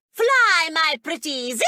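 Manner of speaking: high, loud witch cry sending off her minions; theatrical witch summons; wicked witch call. Acted. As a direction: shriek it out like a storybook witch. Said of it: "I was just practicing".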